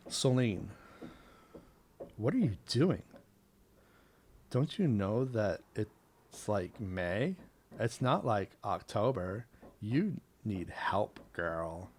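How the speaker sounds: lightly voiced Valley Girl, uptalk; a hint of a bubbly Valley Girl. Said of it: "I'm putting on the Valley Girl voice".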